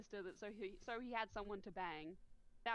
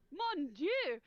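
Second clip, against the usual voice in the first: high, loud Cajun-French exclamation; hammed-up Cajun outburst; Cajun accent; 7 semitones higher, 7 dB louder than usual. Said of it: "brief Cajun accent that I did".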